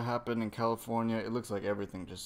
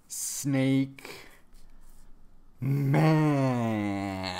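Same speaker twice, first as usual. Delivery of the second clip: each word stretched, with a pause between; drawn-out dramatic announcement. Acted. Fan revealing the new form, drawn-out announcing. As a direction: announce it slowly, holding the last word long.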